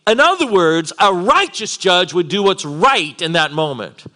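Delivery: high, loud, driving sermon; impassioned preaching. Real, not acted. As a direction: preach it at full force, hammering key words. Preaches at full force.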